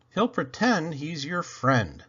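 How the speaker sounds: ordinary delivery, nothing marked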